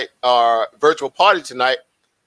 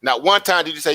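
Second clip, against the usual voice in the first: ordinary talk, nothing marked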